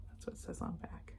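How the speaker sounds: faint unvoiced whisper. Real, not acted. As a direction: barely breathe the aside. Whispered aside.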